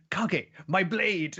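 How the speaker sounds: high, slow, drawn-out call; calling for squire. Retold or imitated. King calling his shadow squire for his blade, hammed-up call. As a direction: summon your squire for your sword.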